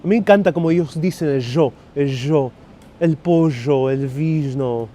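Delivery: drawn-out Argentinian Spanish with sh sounds; Argentinian accent. Acted. Streamer shows viewers their best impression, Argentinian accent. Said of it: "that's my best, uh, Argentinian".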